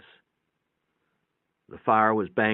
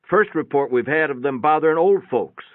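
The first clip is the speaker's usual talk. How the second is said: louder, higher gruff-free trooper voice; trooper's remark; 7 semitones higher, 8 dB louder than usual. Acted. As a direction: speak up like a state trooper reporting. Trooper speaks to Wallace.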